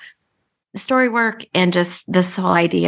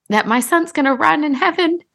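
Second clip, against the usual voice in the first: teary; 9 semitones higher than usual. Real, not acted. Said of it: "I'm gonna get a little teary".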